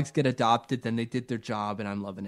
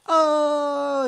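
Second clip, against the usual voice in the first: high, loud hammed-up "oh"; mock amazed gasp; 8 dB louder than usual. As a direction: fake a crowd's big amazed gasp. Crowd amazed in acted scene.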